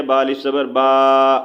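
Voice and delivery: با stretched slowly, mouth wide open; long open baa. Shown as a demonstration. Teacher demonstrates the long alif in با, slowly. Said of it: "پورا منہ ہم نے کھول کے اس کو پڑھنا ہے".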